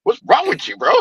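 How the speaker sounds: high-pitched mock grandpa scolding; grandpa yelling at grandson. Old man talking down to grown grandson, mock scolding. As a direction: imitate an old man telling off his grandson. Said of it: "like somebody's grandpa, like, yelling".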